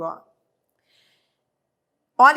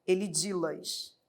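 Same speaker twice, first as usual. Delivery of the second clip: resulting form; 4 semitones lower, 7 dB quieter than usual. Shown as a demonstration. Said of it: "Ele diz as palavras".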